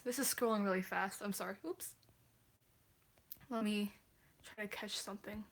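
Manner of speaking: ordinary talk, nothing marked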